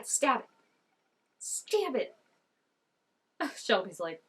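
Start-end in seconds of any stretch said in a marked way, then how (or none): 0.0-2.2 urgent stab it. Real, not acted. Urging the girl.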